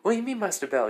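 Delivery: confused surprise. Acted. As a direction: jump up in pitch, alarmed.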